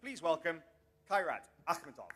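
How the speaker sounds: loud ring-announcer welcome; bringing the fighter up on stage; announcer call-out. Real, not acted. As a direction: belt out the name for the crowd.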